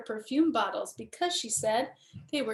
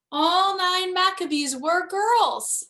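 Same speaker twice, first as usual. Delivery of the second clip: louder, higher and slow, savoring each word; triumphant reveal; 7 semitones higher, 7 dB louder than usual. A narrator's reading. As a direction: announce it like a proud secret. Big reveal of the girl menorah.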